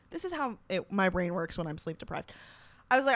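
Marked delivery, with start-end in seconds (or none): none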